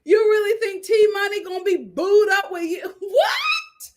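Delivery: cry-laughing in disbelief; screeching laughter at the idea. Real, not acted. Laughing at Deontay.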